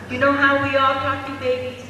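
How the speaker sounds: ordinary delivery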